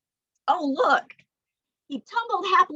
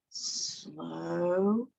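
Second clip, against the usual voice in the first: drawn out and lower; slow; 6 semitones lower, 6 dB quieter than usual. Shown as a demonstration. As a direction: say it long and lazy. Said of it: "one named Slow".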